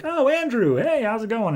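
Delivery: high, friendly mock greeting; warming up. Woman greeting her old companion Andrew, suddenly friendly. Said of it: "warms up to him".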